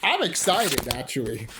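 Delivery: usual speaking voice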